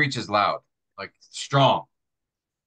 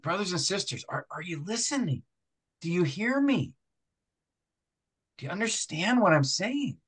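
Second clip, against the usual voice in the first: higher, softer, earnest plea; 6 semitones higher, 4 dB quieter than usual. Shown as a demonstration. Pastor demonstrating an earnest appeal to the congregation. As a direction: lean in and plead, sincere. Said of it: "an earnest tone".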